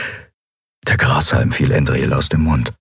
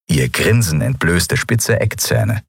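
ordinary delivery, nothing marked

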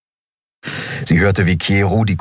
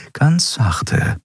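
ein Stück höher, sich selbst zur Ruhe mahnend; sich selbst ermahnend; 7 semitones higher than usual. A narrator's reading. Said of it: "befahl sie sich". (höher, sich selbst gut zuredend)

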